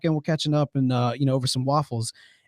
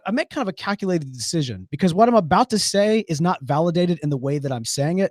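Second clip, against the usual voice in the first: usual speaking voice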